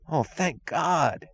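high, slow gasp of relief; relieved cry. A narrator's reading. Young guard sighing with relief at the news. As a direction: let the thanks spill out slowly. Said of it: "cried one of the guards, the youngest".

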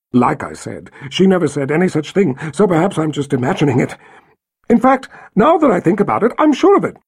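rising, fast, frantic protest; nervous babbling. Acted. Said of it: "visibly forcing herself to stop babbling".

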